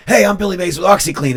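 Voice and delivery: loud, high TV pitchman intro; Billy Mays pitch. Retold or imitated. Recalling the TV ad, booming pitchman voice. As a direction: sell it like the OxiClean ad. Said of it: "That dude was Billy Mays".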